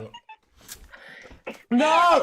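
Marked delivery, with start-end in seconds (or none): 1.7-2.2 startled cry; sudden high yell; shout. Real, not acted. Spilled water on manual.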